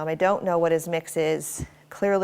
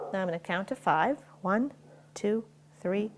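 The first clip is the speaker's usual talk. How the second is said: ordinary talk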